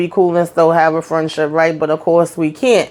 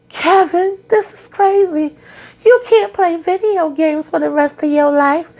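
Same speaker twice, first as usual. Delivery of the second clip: mock Nina; 9 semitones higher than usual. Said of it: "Nina said".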